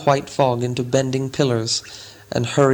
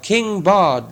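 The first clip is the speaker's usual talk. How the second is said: higher, ringing cheer for the king; chanted cheer, higher pitched; crowd shout; 6 semitones higher than usual. A narrator's reading. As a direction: call the name out again like the crowd. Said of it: "they shouted".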